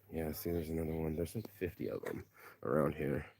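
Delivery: very soft, low spotting aside; hushed aside. Retold or imitated. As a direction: drop right down to point something out quietly. Quiet aside about monkeys nearby.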